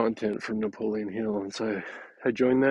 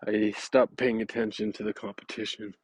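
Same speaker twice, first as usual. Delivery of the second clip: usual speaking voice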